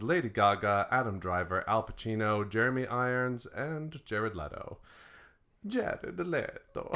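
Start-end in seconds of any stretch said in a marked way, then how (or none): 5.7-6.9 slow, high, hammed-up Italian accent; singsong mock Italian accent. Name repeated in put-on accent.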